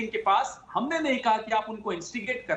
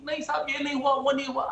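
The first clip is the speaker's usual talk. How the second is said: quick, lightly mocking denial in another voice; mocking the opponents; 2 semitones higher, much faster than usual. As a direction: rattle off their excuses dismissively. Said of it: "are arguing in this, ki".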